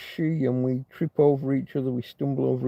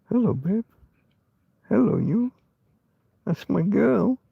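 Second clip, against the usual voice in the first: cooing to the dog; 8 semitones higher than usual. Real, not acted. Man cooing to his old dog Tina.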